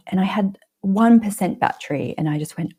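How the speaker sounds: usual speaking voice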